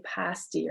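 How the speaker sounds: "pasty" with the a back and low; low back vowel. Shown as a demonstration. Lecturer contrasting UP vowels, "pasty" with a low back a. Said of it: "your tongue goes back and low".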